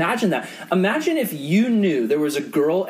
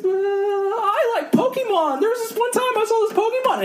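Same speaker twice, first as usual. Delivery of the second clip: put-on high wail sliding into excited kid chatter; put-on little-boy squeal, bawling then suddenly cheerful; crying kid turning chatty. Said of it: "completely snaps out of his crying".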